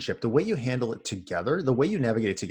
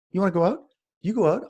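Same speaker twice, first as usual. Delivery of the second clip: higher, taunting dare; dad daring his defiant daughter to leave, taunting; sarcastic challenge; 8 semitones higher, faster than usual. Retold or imitated. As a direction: dare her to try it, mockingly.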